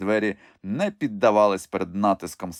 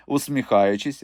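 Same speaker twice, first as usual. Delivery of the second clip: ordinary delivery, nothing marked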